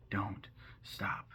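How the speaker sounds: breathy ghostly whisper. Retold or imitated. Vlogger voicing ghostly Edward warning Bella, whispered. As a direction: whisper it like a faint apparition.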